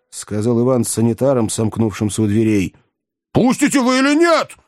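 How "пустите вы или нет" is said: high, furious shouted demand; terrible shout. A narrator's reading. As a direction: roar it in a frightening voice. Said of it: "страшным голосом крикнул".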